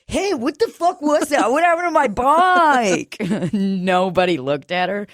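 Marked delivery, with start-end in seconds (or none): 0.1-3.2 shocked. Retold or imitated. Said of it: "looking at them to be like".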